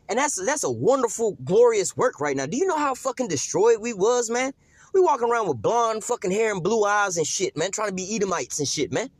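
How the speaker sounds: fired-up preaching. Acted. Preaching at full force.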